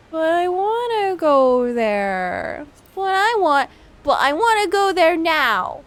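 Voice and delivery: high, drawn-out whine; whiny child. Acted. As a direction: whine like a bratty kid. Player whining in character.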